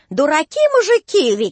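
высокий громкий выкрик иностранца; крикни с ломаным задором; Кинга кричит. A narrator's reading. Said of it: "и кричит". (ломаный крик англичанина)

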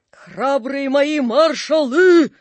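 loud, high, slow rallying cry; commanding shout. A narrator's reading. Napoleon hails his marshals, loud drawn-out shout. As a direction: proclaim it grandly, drawing out the last word. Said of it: "крикнул Наполеон".

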